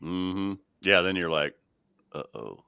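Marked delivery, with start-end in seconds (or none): 2.1-2.6 low, much softer mock dread; small uh-oh. Kid realizing dad is angry, small uh-oh. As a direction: drop low and soft.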